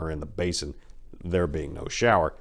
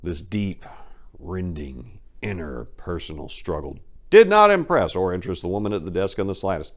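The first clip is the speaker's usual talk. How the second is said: ordinary delivery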